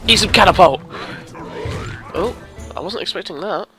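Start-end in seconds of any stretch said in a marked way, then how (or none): none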